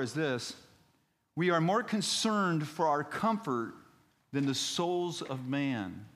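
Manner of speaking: high-pitched, emphatic sermon delivery; preaching. Real, not acted. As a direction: preach it with slow, weighty emphasis on the key line. Preaches at full force.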